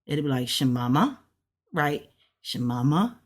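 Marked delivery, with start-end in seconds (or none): 0.5-1.2 "shemama" rising at the end; question tune. Shown as a demonstration. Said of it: "if it was what with a question mark". 2.4-3.2 "Shemama" with a rising questioning end; question tune. Shown as a demonstration. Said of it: "if it was what with a question mark".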